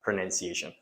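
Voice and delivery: usual speaking voice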